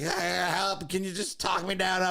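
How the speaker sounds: high, pleading voice; mock panicked plea. Stoned comic pleading with girlfriend over the phone, high and desperate. High man begging for help.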